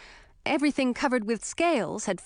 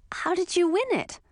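Emma's voice; 8 semitones higher than usual. A narrator's reading. Emma asks Ralph.

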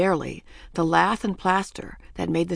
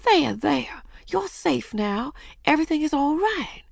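soothing murmur; 8 semitones higher than usual. A narrator's reading. Missus Kidd hugging Ramona, tender soothing murmur. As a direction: comfort a crying child gently. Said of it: "hugged her and murmured".

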